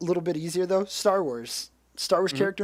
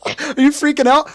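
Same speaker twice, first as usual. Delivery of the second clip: laughing; 8 semitones higher, 6 dB louder, slower than usual. Real, not acted. Friend laughs at his excitement.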